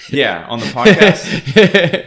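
laughing through the words; chuckling, drawn out. Real, not acted. Joking about podcast, laughs.

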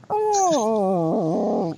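high, let-down little oh; mock disappointment, the hope draining out; lightly acted letdown; deflated oh. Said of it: "And she's like".

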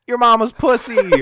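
loud slow yell. Retold or imitated. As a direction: shout it down the hall. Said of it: "he yelled it".

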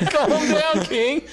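high, loud laughing jab; mock announcer scolding. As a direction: shout it half-laughing like a commentator. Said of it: "I was gonna say".

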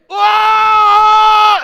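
long, high, full-voiced scream; imitated scream. Storyteller imitating the noise starting up in prayer, piercing scream. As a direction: shriek like someone being murdered. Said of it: "it starts again".

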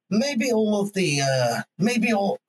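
lightly imitating a posh Scottish broadcaster; posh Scottish accent. Mocking Andrew Neil's views, light posh Scottish accent. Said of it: "in his posh Scottish accent".

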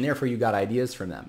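ordinary talk, nothing marked

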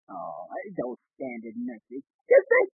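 normal talking voice

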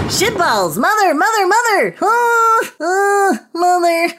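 high, frantic screaming in panic; panicked shriek. Acted. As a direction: wail like the sky is falling. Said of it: "I'm freaking out".

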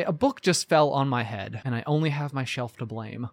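deadpan setup of a pun; understated corny punchline; corny joke delivery. Acted. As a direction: lay the pun down dry and low. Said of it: "a corny funny".

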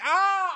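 high startled mock scream. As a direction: shriek like you're scared of the monitor. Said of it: "I'd be like".

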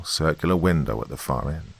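usual speaking voice

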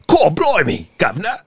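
put-on British accent. Podcaster hunting for the right accent, mock British. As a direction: exclaim like a comic Brit. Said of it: "That's British".

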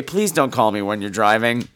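ordinary delivery

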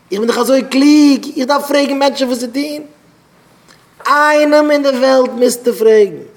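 high, loud acted indignation; heated protest. Arguing over whether to ask someone for advice, loud indignant protest. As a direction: protest at full voice, pitched right up.

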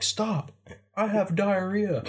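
high, slow mock victim; mock panicked victim. Victim in a video warding off a mugger, high slow plea. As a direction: plead it to scare off the mugger. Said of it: "they're like".

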